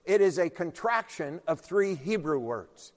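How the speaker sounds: normal talking voice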